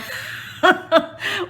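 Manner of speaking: giggling burst, high pitched; high laugh. Real, not acted. Laughing at her own fear of grasshoppers, high giggle.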